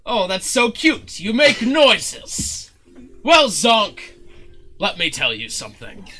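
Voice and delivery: high, loud theatrical cooing; gushing condescending showman. Acted. Showman cooing at Zook's noises, condescending. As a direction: talk down to them with grand delight.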